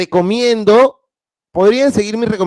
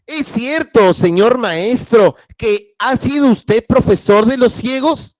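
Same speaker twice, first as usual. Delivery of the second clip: higher, eager schoolboy asking; curious pupil; 6 semitones higher, 3 dB louder, slower than usual. Acted. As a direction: lift the voice into a curious boy. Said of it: "Derossi le preguntó".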